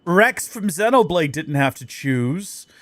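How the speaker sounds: ordinary talk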